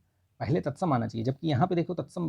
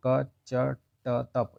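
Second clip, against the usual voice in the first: very slow recitation; much slower than usual. Shown as a demonstration.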